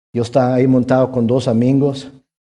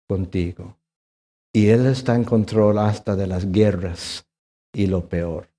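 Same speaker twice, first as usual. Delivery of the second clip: lower, much softer, slow reassurance; tender and soft; 4 semitones lower, 4 dB quieter than usual. Acted. Pastor reassuring the congregation, softly and tenderly. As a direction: comfort them gently.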